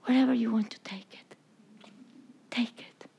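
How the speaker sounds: soft, breathless surrender; whispered prayer. Acted. Preacher whispering a prayer of surrender to God. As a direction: give it up in a hushed whisper.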